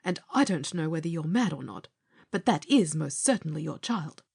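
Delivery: high, brisk, stern rebuke; indignant nurse insisting; severe nurse. A narrator's reading. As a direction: tell him severely, leaving no doubt. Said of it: "replied the nurse severely".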